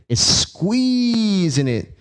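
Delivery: squeezing drawn out slow and high; stress on squeeze. Shown as a demonstration. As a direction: lean hard on the word squeezing. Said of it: "I like to emphasize the word squeeze".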